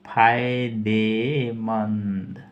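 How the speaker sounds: फायदेमंद drawn out very slowly; slow repeat. Shown as a demonstration. Teacher dictating meaning to students, very slow. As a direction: stretch फायदेमंद so students write it. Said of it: "beneficial का अर्थ है".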